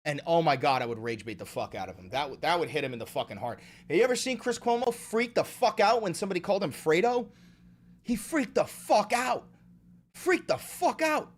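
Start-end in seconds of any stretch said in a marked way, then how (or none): 3.9-11.3 high-pitched, wound-up disbelief; excited rant. Real, not acted. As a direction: squeal it with glee. Excited rant about Cuomo.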